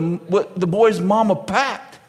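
ordinary talk, nothing marked

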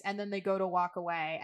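ordinary talk

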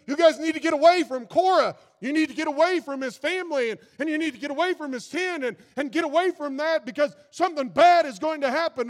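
loud, urgent call to get away; urgent warning. Retold or imitated. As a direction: warn the crowd to flee, raising the voice. Moses warning people to flee.

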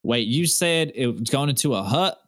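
ordinary talk

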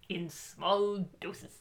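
drawn-out and deliberate; slow and deliberate. Acted. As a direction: stretch every word. Slow emphatic aside.

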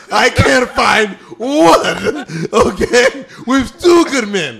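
loud, high, drawn-out protest; exasperated shout. Real, not acted. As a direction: stretch each word in loud, half-laughing exasperation. Exasperated argument, laughing.

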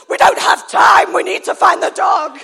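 panicked yelling. Retold or imitated. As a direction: shout down a wailing child. Panicked mother answers crying daughter.

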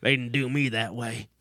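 put-on S0 reading the frightened abductee's transcript in a Southern accent; dramatic Southern accent. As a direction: act out the terrified witness in a thick Southern accent. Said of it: "this dramatic monologue there in a Southern accent".

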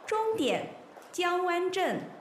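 usual speaking voice

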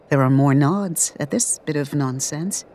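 ordinary delivery, nothing marked

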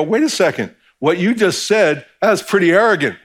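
mock affronted challenge; incredulous objection. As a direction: push back like a doubting listener. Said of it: "you'd go".

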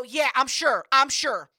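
loud sarcastic brush-off. Retold or imitated. Patient brushing off the phlebotomist, sarcastic. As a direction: throw it back, sarcastic.

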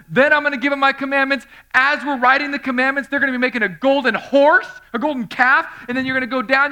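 loud, high, driving preacher voice; full-force preaching. Acted. Preacher proclaiming God's gift to the congregation, loud and high. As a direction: hammer the grace message home.

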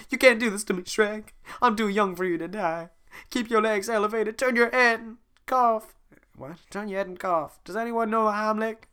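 high, frantic talking donkey; panicked Donkey. Acted. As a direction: babble in a fast panic. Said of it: "most genuine donkey impression".

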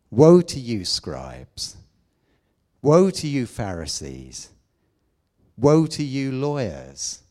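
loud, slow, thundering proclamation of woe; Jesus pronouncing woe on the cities, at full preaching force; preached woe. Acted. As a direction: call down woe like a prophet from the pulpit. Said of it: "He says".

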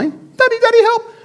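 put-on high, loud, panicked child's cry; child crying for help. Daughter clinging to a tree branch calls her dad, panicked high cry. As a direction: scream for daddy as if slipping from a branch. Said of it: "I hear this cry".

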